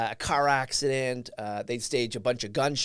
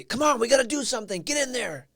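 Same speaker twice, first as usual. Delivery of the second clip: high, urgent retold order to move; mock frantic yell, not full volume; 9 semitones higher than usual. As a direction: imitate a frantic officer's yell, pitched up. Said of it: "yelling frantically".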